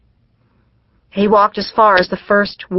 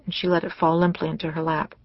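usual speaking voice

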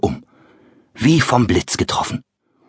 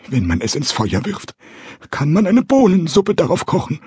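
put-on much higher, trembling old carpenter; frightened stammer; 10 semitones higher than usual. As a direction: stammer in rising panic. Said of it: "stammelte er, schlotternd vor Angst".

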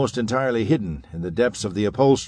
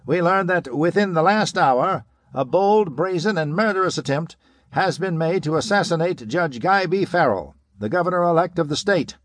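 higher, booming broadcast read; radio announcer; 7 semitones higher than usual. A narrator's reading. Radio announcer reporting the assassination attempt, broadcast voice. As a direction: sound like a news flash over the radio. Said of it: "The announcer's voice billowed into the room".

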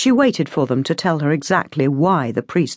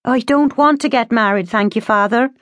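put-on higher-pitched young daughter; polite refusal; 7 semitones higher than usual. A narrator's reading. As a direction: turn the priest down politely but firmly.

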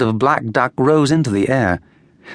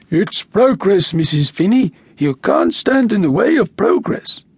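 gravelly, stiff grim official voice; mock a pompous council man, gruff; gravel-voiced council man; 6 semitones higher than usual. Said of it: "Carey put on a gravel voice".

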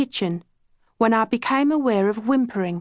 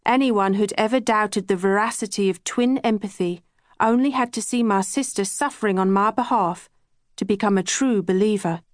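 plain reading voice

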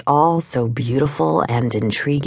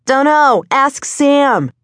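high, louder shout back; calling back; 11 semitones higher, 4 dB louder, slower than usual. A narrator's reading. Kid riding off to woman, calling back over his shoulder. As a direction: toss the answer back from a distance. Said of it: "he called back over his shoulder".

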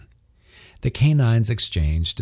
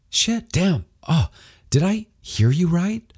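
higher startled outburst; shocked wife; 11 semitones higher than usual. A narrator's reading. Wife dropping the ladle at the news, startled and higher. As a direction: sound the floor just dropped out.

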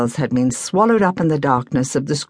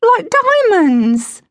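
put-on high, slow, marvelling girl's voice; awed delight; 4 dB louder, slower than usual. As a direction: gasp it out like seeing treasure. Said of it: "cried Penelope".

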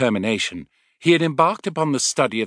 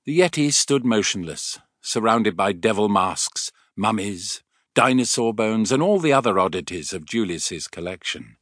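ordinary delivery, nothing marked

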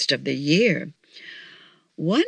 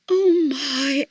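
mildly exclaimed, higher-pitched; exclamation; 7 semitones higher than usual. A narrator's reading. Missus Claus to Santa by the fire, mildly exclaiming. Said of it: "exclaimed Missus Claus".

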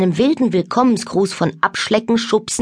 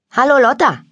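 higher-pitched hello; boy's greeting; 6 semitones higher, 4 dB louder than usual. A narrator's reading. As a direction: greet a new cousin brightly, falling at the end. Boy greets his newly arrived cousin.

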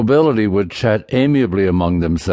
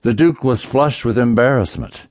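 plain reading voice

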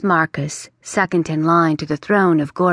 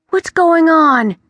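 slow, much higher, louder alarmed question; rattled and pleading; 11 semitones higher, 7 dB louder than usual. Acted. The prince asks the bat what is happening, alarmed.

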